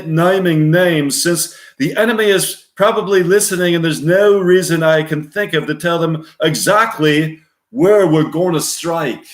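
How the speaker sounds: high, projected officer's briefing voice; mission briefing officer. Acted. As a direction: address the troops before a mission.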